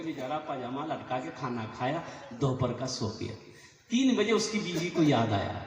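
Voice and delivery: ordinary talk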